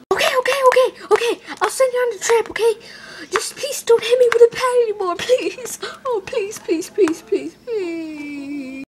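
high, whimpering plea; desperate begging. Acted. Mario begging not to be hit, whimpering desperately. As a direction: beg frantically, fading into whimpers.

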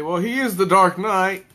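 lightly voiced fan piping up in protest; imitating objecting fans. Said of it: "people are gonna say".

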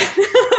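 a short, high, loud laugh; embarrassed laugh. Real, not acted. Doctor laughing at her own age, short and high. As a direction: laugh at how long ago it was.

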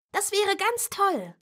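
high eager delight; excited child. Acted. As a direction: gush like a happy little girl. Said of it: "rief Nina begeistert".

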